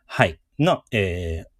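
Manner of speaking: usual speaking voice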